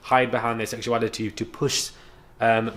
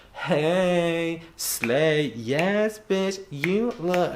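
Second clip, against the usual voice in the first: higher, drawn-out camp mock greeting; mocking gushy hype; 6 semitones higher, much slower than usual. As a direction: stretch out bitch, you look in a mock squeal.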